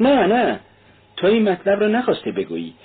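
high, insistent objection; protesting denial. A narrator's reading. Razumikhin telling his friend he can't mean it, protesting.

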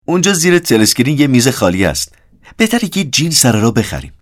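high, loud character voice; animated Syme. Acted. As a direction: call out a suggestion over a noisy room. Narrator voices Syme calling Winston over. (صدای بلند و زیرِ سایم)

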